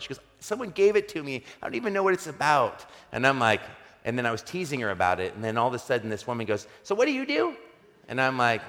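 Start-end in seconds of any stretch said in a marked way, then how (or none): 0.4-2.8 high-pitched, quick defensive imitation; mock a woman excusing herself, pitched up; higher put-on voice, hurried at the end; retold woman's protest. 6.8-7.5 high-pitched imitation, lifting at the end; put-on high voice, inquisitive; retold woman's question.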